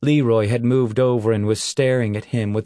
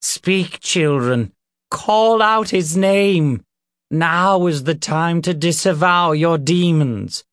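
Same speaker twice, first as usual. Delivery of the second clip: raised, high preacher's call; exhorting reverend; 7 semitones higher, 3 dB louder than usual. Acted. Reverend ordering children to disavow demons, preaching. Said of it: "on and on the Reverend went".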